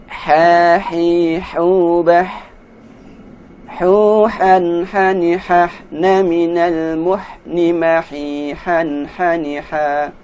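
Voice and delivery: teacher modelling ha syllables; recitation drill. Shown as a demonstration. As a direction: chant each syllable clearly. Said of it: "drill syllables".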